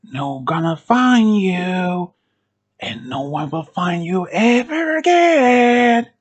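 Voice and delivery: slow, drawn-out taunt, rising at the end; creepy stretched-out threat; maniac clown. Acted. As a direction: drag the words out to menace hidden prey. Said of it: "Laughing is like a maniac".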